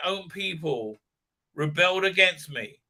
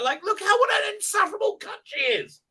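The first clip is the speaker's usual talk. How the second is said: exasperated rant. Real, not acted. Ranting about the character.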